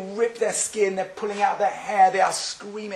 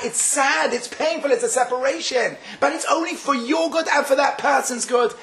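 high-pitched, fervent sermon delivery; insistent, high-pitched exhortation; impassioned preaching; 8 semitones higher than usual. Real, not acted. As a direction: preach it with rising fervor, pitched well up. Preaches on mourning with raised voice.

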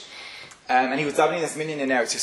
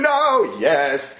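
mock terrified; 9 semitones higher, 4 dB louder than usual. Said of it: "And they're shaking".

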